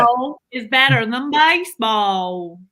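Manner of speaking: slow drawl, hanging on the A in baseball; Southern accent. Shown as a demonstration. Wife reads chat line in practice Southern accent. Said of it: "you hung on to that A in baseball for so long".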